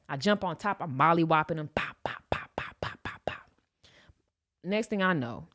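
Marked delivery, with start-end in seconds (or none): 1.7-3.5 punch sounds. Retold or imitated.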